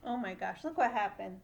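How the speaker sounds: ordinary delivery, nothing marked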